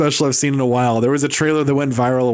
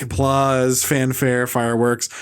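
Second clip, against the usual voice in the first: ordinary talk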